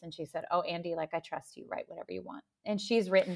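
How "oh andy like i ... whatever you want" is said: gentle reassurance. Retold or imitated. Mom voiced, giving her trust.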